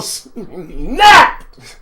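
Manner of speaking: drawn-out build to a loud high burst; dramatic outburst. A narrator's reading. Host telling how the singer lost it, explosive. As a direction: hold back, then explode on the word.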